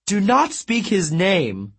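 a vain sulky god making excuses; dramatic god Apollo. A narrator's reading.

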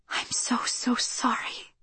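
slow unvoiced whisper. A narrator's reading. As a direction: breathe an apology in a whisper. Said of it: "she whispered".

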